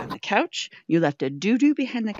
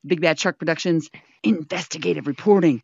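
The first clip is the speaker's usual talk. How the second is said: usual speaking voice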